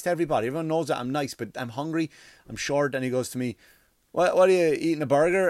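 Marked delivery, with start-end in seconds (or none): 4.1-5.5 loud, higher-pitched pestering question; mock nosy question. Pesky man interrupts him at his meal, mock nosy question. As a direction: blurt it out loudly, imitating a pest.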